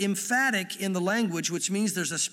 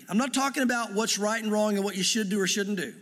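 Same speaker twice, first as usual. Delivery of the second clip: normal talking voice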